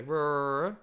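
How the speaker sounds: high, loud rolled growl; mock growl at mum in the doorway; silly growl. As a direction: growl back like a playful animal. You make a noise back.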